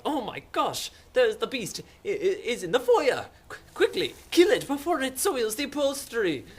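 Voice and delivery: high, loud, stammering alarm; prissy mock panic. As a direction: fret like flustered gentry under attack. Vikings in prissy panic, acted.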